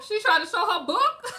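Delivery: high, loud, laughing disbelief; incredulous laughing question. Real, not acted.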